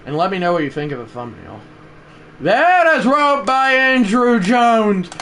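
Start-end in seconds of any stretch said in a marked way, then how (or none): 2.4-5.1 shouted, high, slowed announcer call; home-run call. Acted. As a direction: stretch every word for the home run.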